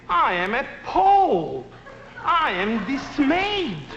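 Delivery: slow, high, pompous big-word outrage; hammed-up teacher with long words; mock grand indignation. Shown as a demonstration. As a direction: savour each fancy word slowly. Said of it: "big English words".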